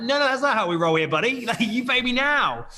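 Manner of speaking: high-pitched mock protest; insist, playfully outraged, on being paid; slowing into an emphatic demand; indignant refusal. Retold argument over money.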